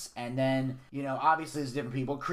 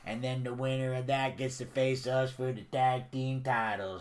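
mock hillbilly accent. The Don explains the match to Cody in mock hillbilly accent. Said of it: "didn't speak in fucking hillbilly English".

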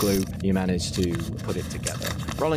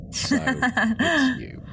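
drawn-out reveal; much slower than usual. A narrator's reading. Game master teasing a player about height, slow high drawl.

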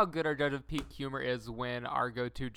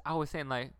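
normal talking voice

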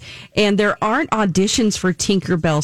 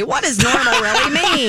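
crazy person voice; 5 semitones higher than usual. Retold or imitated. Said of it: "Things that crazy people say".

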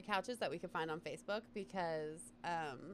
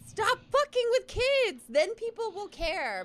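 high, loud, slow furious shout; angry outburst; 9 semitones higher, 11 dB louder than usual. Real, not acted. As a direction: bark it slowly in outrage. Angry at abuser.

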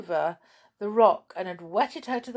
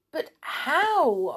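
anxious; 9 semitones higher than usual. Acted. Tom anxious question.

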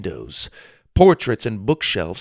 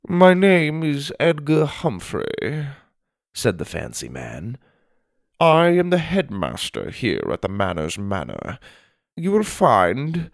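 pompous headmaster; 4 semitones higher than usual. Acted. Headmaster introduces himself to new boy, pompous and formal. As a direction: introduce yourself with grand formality.

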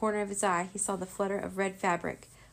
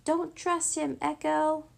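high, urgent warning yell; sudden yell; 8 semitones higher, 4 dB louder, slower than usual. A narrator's reading. Rory warns Echo about Maldred, yelling. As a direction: call out loudly to warn her. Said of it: "Suddenly Rory yelled".

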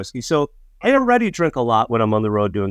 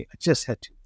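softer repeat, almost under the breath; rueful echo; 7 dB quieter, much faster than usual. Real, not acted. Telling podcast host about the drunk night, softer echo. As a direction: drop it down as an aside.

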